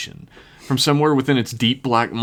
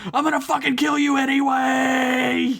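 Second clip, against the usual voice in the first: screaming. A narrator's reading. Jason charging Rio with his axe up, wild high scream. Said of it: "he just screamed really loudly".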